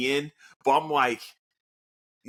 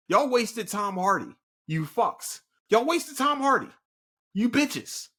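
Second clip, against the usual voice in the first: high-pitched indignant scolding; angry ranting; 8 semitones higher than usual. Real, not acted. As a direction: tell them off, pitch climbing. Ranting at filmmakers over wasted actor.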